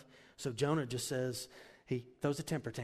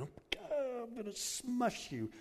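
much higher, slow, cartoonish threat; mock angry God; 11 semitones higher than usual. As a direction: play God as a silly bully. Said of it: "God is not, like, going".

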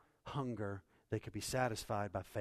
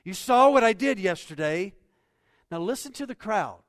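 loud, higher preaching voice; fervent sermon delivery; 8 semitones higher, 14 dB louder than usual. Acted. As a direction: preach it out to the room. Preacher at full volume.

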